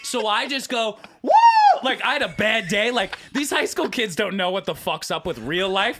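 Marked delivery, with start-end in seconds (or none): 1.2-1.3 top-of-the-lungs whoop. Retold or imitated. Rider showing the instructor he is ready, full-throated whoop. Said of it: "like, at the top of my lungs".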